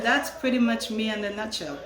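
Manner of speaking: usual speaking voice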